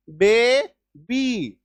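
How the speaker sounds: बे, बी stretched and split in two; बे and बी as two long beats; two syllables. Shown as a demonstration. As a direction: draw out बे, then बी separately. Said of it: "दो syllable".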